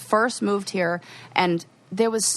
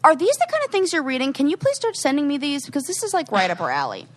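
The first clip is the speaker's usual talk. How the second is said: excited plea; 6 semitones higher than usual. Retold or imitated. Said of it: "I'm like".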